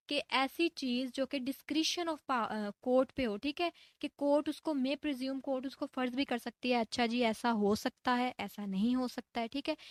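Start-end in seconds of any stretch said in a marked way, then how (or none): none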